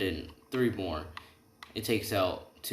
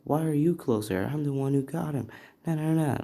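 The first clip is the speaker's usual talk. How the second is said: higher-pitched, indignant protest; mild acted shouting; 6 semitones higher, 4 dB louder than usual. Rival arguing over who is closer to him, mildly shouted. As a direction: argue back in a raised, childish squabble. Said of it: "He's just shouting now".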